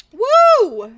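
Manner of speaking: high loud whoop; cheer. Real, not acted. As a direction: celebrate with a big woo. Happy cheer.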